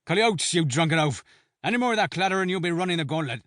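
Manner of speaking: high, fast snarled threat; snarling. A narrator's reading. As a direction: snarl it right back. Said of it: "Shea snarled back".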